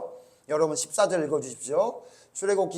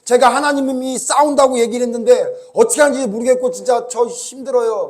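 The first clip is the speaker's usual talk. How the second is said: loud, higher pleading cry to God; desperate cry; 5 semitones higher, 10 dB louder than usual. Acted. Moses crying out to God in distress, loud desperate plea. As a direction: cry out to God at the end of your rope. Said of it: "이렇게 부르짖었어요".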